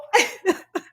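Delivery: high-pitched burst of laughter; amused high cackle; real laugh. Real, not acted. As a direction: laugh it out, overcome. Laughs at her own story.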